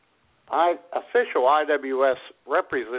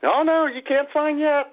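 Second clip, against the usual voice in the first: mock grandma; 9 semitones higher than usual. Podcast host mimicking a grandma who won't let you sign, high and pleading. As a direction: play the doting grandma stalling the signing. Mocking clingy grandma at signing.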